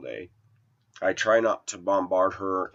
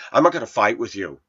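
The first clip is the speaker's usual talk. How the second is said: curt retort; 3 semitones higher, 7 dB louder, faster than usual. Retold or imitated. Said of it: "her exact response".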